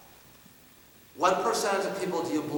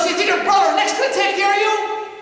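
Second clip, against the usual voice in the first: much higher and louder, a sharp demanding question; dad's pointed challenge; 10 dB louder than usual. Retold or imitated. Father challenging his son, loud demanding question. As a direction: corner him with the question. Dad confronting son again.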